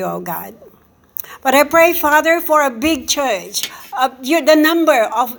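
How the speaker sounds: ordinary delivery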